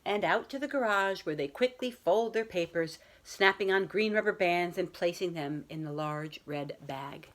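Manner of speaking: put-on children's picture book read aloud to a class; story time voice for young listeners; storybook reading. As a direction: read it like a teacher sharing a bedtime story. Teacher reads picture book aloud.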